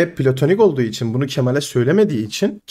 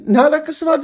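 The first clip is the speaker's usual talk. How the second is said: high, heated mock protest; imitating flustered denial; 8 semitones higher than usual. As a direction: act caught out and rattled. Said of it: "bu kadar heyecanlandın, sinirlendin, bağırdın". (yüksek sesle, telaşlı taklit inkâr)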